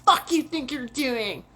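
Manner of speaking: hammed-up angry officer; imitating angry cop. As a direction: play the cop as rude and impatient. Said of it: "He's all".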